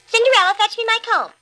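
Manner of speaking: high, loud bossy demand; bossy stepsister. A narrator's reading. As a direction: order her about, high and shrill. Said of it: "looking at themselves in the mirror".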